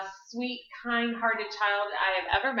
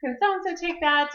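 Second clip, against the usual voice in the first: mock sing-song question; 8 semitones higher than usual. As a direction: lilt it like a pestering caller, half singing. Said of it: "We're like".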